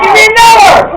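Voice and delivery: high, loud yell for another round; hammed-up party shouting; rowdy drinker's shout. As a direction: holler at the bartender, acting tipsy. Acting out demanding more drinks.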